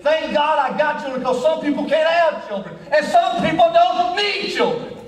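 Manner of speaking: high, impassioned sermon delivery; full-force preaching. Acted. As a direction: preach it hard, pitch climbing to the last line.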